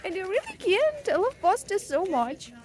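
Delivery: loud, high-pitched outburst; exasperated lament. Real, not acted. Exasperated outburst about giving up pasta.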